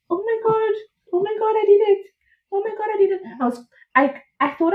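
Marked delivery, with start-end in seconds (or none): 0.1-3.2 higher, breathless amazement; overwhelmed with joy; amazed elation. Retold or imitated. New mother realizing she did it, elated.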